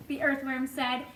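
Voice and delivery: ordinary delivery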